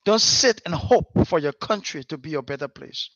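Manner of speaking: usual speaking voice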